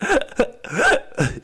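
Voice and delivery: short high-pitched laugh. Real, not acted. Laugh over dry heaving.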